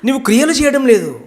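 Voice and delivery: high, loud rebuke from the Lord; firm reply. Acted. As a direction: correct him firmly and loudly. Jesus answers the believer. (పెద్ద స్వరంతో గట్టిగా మందలింపు)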